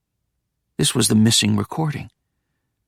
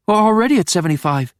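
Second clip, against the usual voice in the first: higher, faster protesting voice; lightly voiced higher assistant; 9 semitones higher, 3 dB louder, faster than usual. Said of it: "high voice replied".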